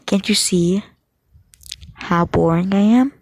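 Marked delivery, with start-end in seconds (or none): none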